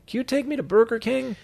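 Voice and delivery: high-pitched roadside holler; calling out. Retold or imitated. Pulling over to offer Eddie a ride, high-pitched calling out.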